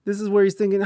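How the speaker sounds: normal talking voice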